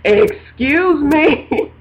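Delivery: mock indignation, milking each syllable; frustrated calling out. Speaker re-enacting calling on God, mock indignant. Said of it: "when I start calling on him and going".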